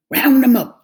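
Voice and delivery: loud high call across the yard. A narrator's reading. Farmer calls out to the dog. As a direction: shout it out to the dog. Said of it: "the farmer called".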